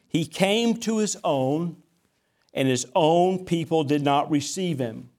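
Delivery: scripture reading. Acted. Reads scripture aloud.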